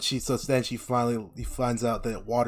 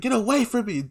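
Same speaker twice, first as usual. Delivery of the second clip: high mock-upset get away; upset rejection; 11 semitones higher than usual. Katara pushes Aang away, high and hurt. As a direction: push him off, hurt. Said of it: "she's like".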